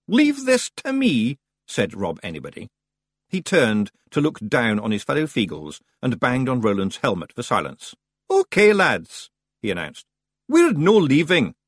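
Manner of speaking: rally the lads; Scots Feegle. Acted. Rob Anybody tells the ferryman they stay.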